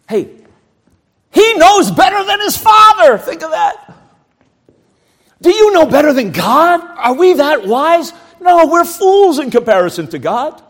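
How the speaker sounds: high, loud rebuke; fervent preaching. Acted. Preacher challenging the congregation's pride, fervent. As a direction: challenge them hard.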